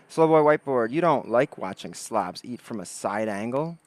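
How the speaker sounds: normal talking voice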